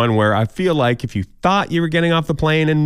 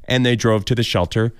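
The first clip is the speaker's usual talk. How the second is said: ordinary talk, nothing marked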